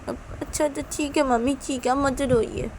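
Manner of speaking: put-on high, small child's voice; consoling little daughter. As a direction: give in and comfort mother. बच्ची माँ को चुप कराती है. (माँ को चुप कराती नन्ही बच्ची)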